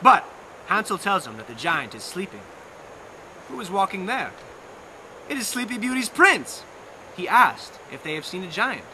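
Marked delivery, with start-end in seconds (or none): none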